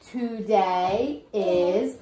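slow, drawn-out model for repeating; slow choral model. Shown as a demonstration. Teacher models the line for kids to repeat, slowly. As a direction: stretch each word so kids can follow. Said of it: "Say it with me".

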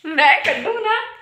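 giggling admission; laughing. Real, not acted. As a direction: laugh while owning up cheekily. Laughing banter with husband.

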